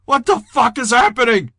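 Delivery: high, loud distraught cry; sobbing panic. A narrator's reading. Sobbing Jeter warding off an evil spirit, panicked cry. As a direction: wail it in mounting panic. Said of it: "He was sobbing".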